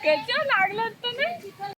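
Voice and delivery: high, slow cooing. Real, not acted. Talking to baby.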